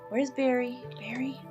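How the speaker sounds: ordinary delivery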